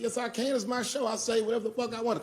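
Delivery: high-pitched indignant comeback; defiant retort. Retold or imitated. Comic answers the objecting woman, high defiant retort. Acted argument with the heckler.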